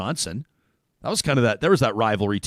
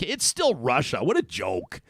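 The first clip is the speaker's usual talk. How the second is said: ordinary talk, nothing marked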